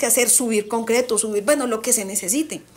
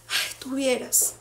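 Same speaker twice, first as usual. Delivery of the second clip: dramatic; 7 dB quieter, slower than usual. Retold or imitated. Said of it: "todo dramático".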